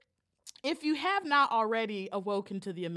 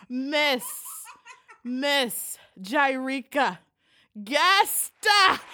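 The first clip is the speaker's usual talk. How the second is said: slow, loud name call like a ring announcer; hype announcement; 3 semitones higher, 9 dB louder, much slower than usual. Acted. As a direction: draw out the name for the big reveal.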